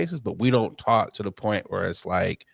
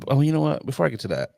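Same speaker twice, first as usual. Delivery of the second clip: ordinary talk, nothing marked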